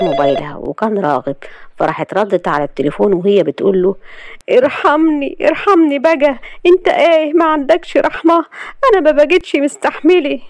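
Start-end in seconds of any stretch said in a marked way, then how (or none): 4.6-10.4 high, tearful begging; crying plea. A narrator's reading. Layla tearfully begging Ragheb on the phone, high. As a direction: beg for mercy, close to tears. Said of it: "انك كنت بتعيطي".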